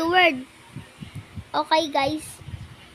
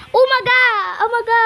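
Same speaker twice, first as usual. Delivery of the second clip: loud call; 5 semitones higher, 7 dB louder, faster than usual. Real, not acted. Shouting a greeting to another player.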